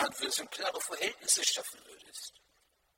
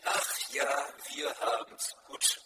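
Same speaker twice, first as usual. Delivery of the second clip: lower, louder and slower, beaming ach ja; 7 semitones lower, 4 dB louder, slower than usual. Retold or imitated. Klaus Vollmer answering the sighing pastor, beaming. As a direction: say it with a glowing smile. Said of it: "er guckte mich an und strahlt".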